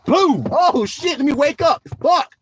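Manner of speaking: high, loud jolted yelp; startled crash. Acted. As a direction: act being jolted awake by the crash. Acting a car hitting curb.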